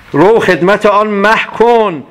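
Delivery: slow, drawn-out verse line; poem recitation. Acted. Teacher repeating the verse for students, slow and deliberate. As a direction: savour the line as you recite.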